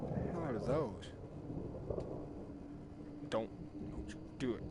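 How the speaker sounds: quick tense whisper. Real, not acted. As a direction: hiss it under your breath. Tense moment in game.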